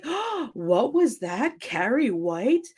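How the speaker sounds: slow, drawn-out sneering mockery; mocking. Teacher mocking Carrie's answer in front of class, slow and sneering. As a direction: stretch it out like a teacher mocking a shy girl. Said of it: "this teacher starts mocking her".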